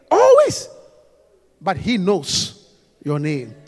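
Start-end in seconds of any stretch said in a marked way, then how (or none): 1.6-3.5 low, slow and deliberate. Real, not acted. Preacher builds slowly toward the climax. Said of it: "slow low build start".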